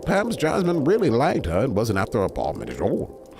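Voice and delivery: ordinary delivery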